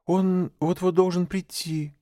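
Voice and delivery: put-on high, nervous boy voice; clumsy lie. A narrator's reading. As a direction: push the lie too eagerly. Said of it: "неумело солгал Маугли".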